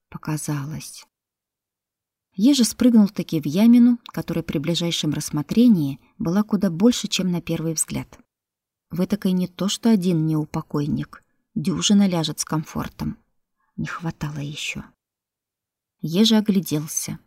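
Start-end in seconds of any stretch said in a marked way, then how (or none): 0.1-1.1 soft, breathy answer to himself; whispered. Acted. 13.7-14.8 whispered. Acted. Hushed aside, measured whisper.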